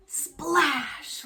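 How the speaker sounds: usual speaking voice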